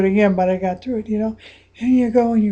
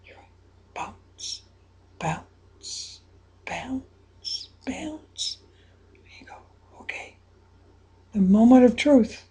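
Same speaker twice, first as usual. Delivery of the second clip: soft whisper, some quick stretches; hushed whisper guidance; 9 dB quieter than usual. Acted. Coach guiding a listener before the shot, soft whisper. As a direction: whisper them through the tense moment.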